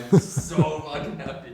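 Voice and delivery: loud, laughing through the words; laughing with joy. Real, not acted. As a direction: burst out happy, laughing as you say it. Interviewer gushing, laughter heard.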